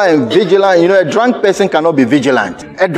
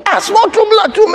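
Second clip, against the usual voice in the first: preacher's cry; faster than usual. Acted. Preacher cries out mid-sermon.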